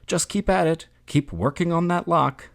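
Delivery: cheering shout. A narrator's reading. Family urges Gregor to work the lock, cheering shout. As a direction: urge him on in a lifted, rooting voice. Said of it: "they should have shouted".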